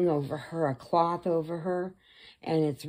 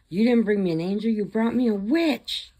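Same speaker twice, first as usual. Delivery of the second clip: raised, higher, indignant outburst; acted angry accusation; 5 semitones higher, 5 dB louder than usual. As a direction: scold them loudly for the blunder. Acted father's furious accusation.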